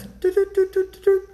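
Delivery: high-pitched "doot" keypad tones; squeaky mimicked dial tones; phone dialing beeps. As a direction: imitate quick phone button beeps. Imitates dialing nine one one.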